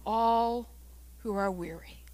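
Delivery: low, soft, unhurried comfort; tender invitation. Acted. Preacher giving Christ's call to the weary, tender. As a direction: offer it gently, with long pauses.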